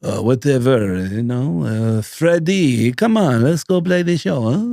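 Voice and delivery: slow, lazy mock French accent. Imagining [name] talking to [name] in a lazy mock French accent. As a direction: play a bored Frenchman drawling it out. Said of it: "He did that French accent".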